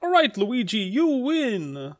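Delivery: high-pitched beaten fighter giving in; Mario. Acted. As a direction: concede defeat in a high cartoon voice. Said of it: "This is Mario. He's laying on the ground, and he says".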